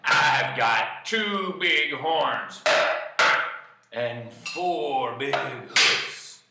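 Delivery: loud, slow, weighty declaration; defiant goat. Acted. Big goat warning off the troll, loud and defiant. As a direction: stretch each word out boldly.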